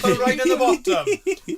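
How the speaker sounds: mock Welsh accent. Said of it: "your best Coco T V accent".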